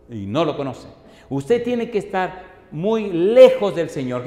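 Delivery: loud, emphatic sermon delivery with stretched key words; impassioned preacher, punching phrases and dropping for the closing words; forceful preaching. Real, not acted. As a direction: drive each point home to the congregation.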